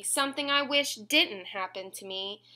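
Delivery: usual speaking voice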